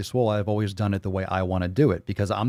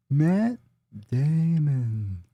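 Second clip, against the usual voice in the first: imitating Matt Damon; 6 semitones higher, much slower than usual. Imitating the Team America line.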